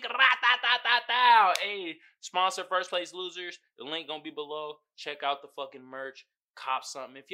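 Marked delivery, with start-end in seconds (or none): 0.0-1.5 slow rat-a-tat sound effect; spray a mock gun sound; mock gunfire. Real, not acted. Vlogger hyping fifa gang, slow mock gunfire.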